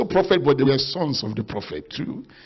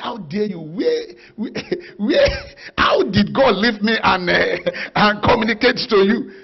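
angry prophet; 4 semitones higher than usual. Retold or imitated. Preacher acts out prophet confronting a rival, angry. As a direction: act out indignant disbelief, breaking into a laugh. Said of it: "one of the prophets was angry".

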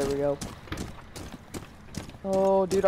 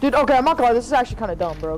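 excited rant; 6 semitones higher, 4 dB louder, faster than usual. Real, not acted. Excited complaint at teammate.

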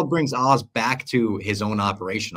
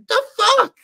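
loud, squeaky falsetto outburst; voice cracking; 9 dB louder than usual. Retold or imitated. Podcaster imitates Penguin standing up stunned, voice cracking high. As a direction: imitate a voice breaking sky-high in disbelief. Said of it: "His voice cracks as he's saying it".

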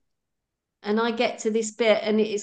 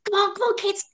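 much higher, brisk mum calling; hurrying parent; 11 semitones higher, faster than usual. Retold or imitated. Parent hurrying kids late for school, much higher brisk call. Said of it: "and you say".

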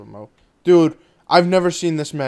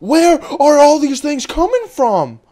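high, loud, slow yell; alarmed cry as enemies swarm in; overwhelmed shout; 11 semitones higher, 8 dB louder, slower than usual. Real, not acted. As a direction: stretch out each word in a frantic holler.

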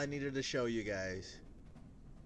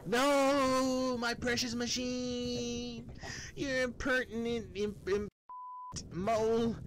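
defeated villain wailing; 13 semitones higher, 4 dB louder, slower than usual. Acted.

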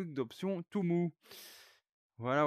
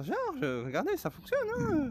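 voix très aiguë; 4 dB louder than usual. Real, not acted. S'étonne que ça marche.

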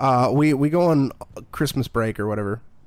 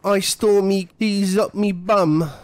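slow, high mock British voice; terrible British accent; 8 semitones higher, 4 dB louder than usual. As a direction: ham up a bad British accent, drawn out. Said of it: "really terrible British accent".

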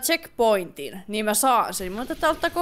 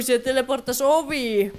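ordinary talk

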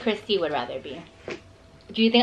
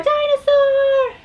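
high, slow drawn-out squeal; playful high squeal; 6 dB louder, much slower than usual. Acted. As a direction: stretch it out, very high. Echoing costume, playful.

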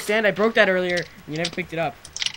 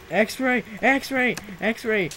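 high, raised repeated shouts; calling out; 6 semitones higher, 5 dB louder, much slower than usual. Real, not acted. Player accusing his friend of cheating, high shouts. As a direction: shout it out higher and louder, repeatedly.

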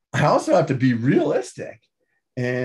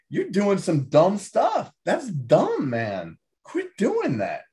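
mock scolding, higher and slower; blunt scolding; 8 semitones higher, slower than usual. Telling himself off for dumb habits, mock scolding pitched high. Blunt truth he needs told.

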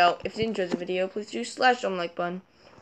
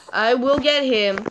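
normal talking voice

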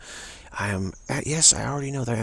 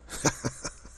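brief, airy chuckle; spiritual laughter; 3 semitones higher, 4 dB quieter than usual. Real, not acted. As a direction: laugh softly as if spirit is amused. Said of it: "laughter, spiritual laughter".

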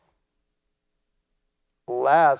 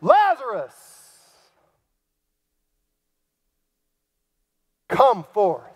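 loud cry; 5 semitones higher, 5 dB louder, slower than usual. Acted. Jesus crying out to dead Lazarus, a loud summons. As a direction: call the name out high and loud. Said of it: "with a loud voice".

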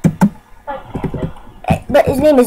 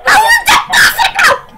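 very high, squealed disbelief; mock-crying outburst; 12 semitones higher, 3 dB louder, slower than usual. Real, not acted. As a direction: wail it as if about to cry. I'm crying.

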